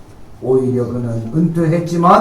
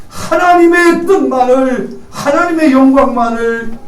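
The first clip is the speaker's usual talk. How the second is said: impassioned preaching; 8 semitones higher than usual. Acted. Climactic preaching passage.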